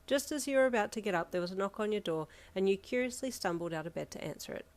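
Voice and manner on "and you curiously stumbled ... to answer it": plain reading voice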